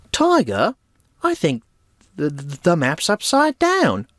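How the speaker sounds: high, lightly voiced cartoon dog; Kipper voice. Quoting Kipper cartoon line.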